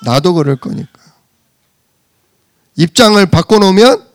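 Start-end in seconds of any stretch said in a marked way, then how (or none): none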